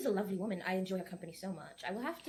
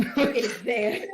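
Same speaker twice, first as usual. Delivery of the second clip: loud laughing reveal; 4 semitones higher, 13 dB louder, slower than usual. Real, not acted. Player laughs out loud while presenting the wine. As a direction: burst out laughing through the words.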